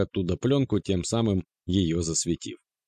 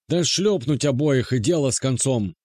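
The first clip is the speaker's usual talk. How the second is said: put-on raspy old man's shout from the stands; hoarse shout; 5 semitones higher, 3 dB louder than usual. A narrator's reading. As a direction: bark it out hoarsely, higher. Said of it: "раздался вдруг сиплый голос".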